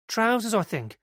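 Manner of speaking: put-on earnest young witness telling it eagerly; girl's voice.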